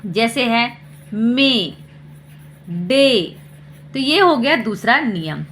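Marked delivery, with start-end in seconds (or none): 1.1-3.4 मे, डे as separate falling "ay" words; long A sound. Shown as a demonstration. Teacher giving AY examples, मे, डे with long "ay". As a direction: give मे and डे a clear long "ay". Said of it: "long sound लेंगे ए का".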